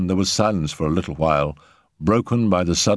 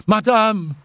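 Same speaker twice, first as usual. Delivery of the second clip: high, urgent call; alarmed cry; 13 semitones higher than usual. A narrator's reading. Elise bursts in calling her mistress, alarmed cry. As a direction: cry out on rushing in. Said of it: "Elise rushed in, crying".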